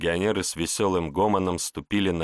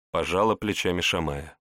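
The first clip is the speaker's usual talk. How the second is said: ordinary delivery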